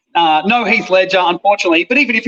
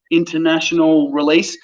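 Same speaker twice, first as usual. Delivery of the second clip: writing it down; 5 semitones lower than usual. Retold or imitated. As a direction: sound out "international release" word by word, as if noting it. Writing down what the agent said.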